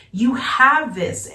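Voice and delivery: ordinary talk, nothing marked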